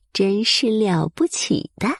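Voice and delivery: plain reading voice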